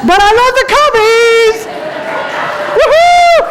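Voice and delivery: high, loud fan's shout; excited cheer. Real, not acted. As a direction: whoop it out for the beloved team. Cheers for their favorite team.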